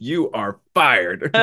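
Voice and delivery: slow, drawn-out pretend dismissal; mock firing verdict. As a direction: deliver a hammed-up verdict, word by word.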